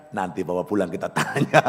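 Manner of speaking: quoting wife through laughter; laughing retelling. Retold or imitated. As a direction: say it while chuckling. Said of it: "dia bilang".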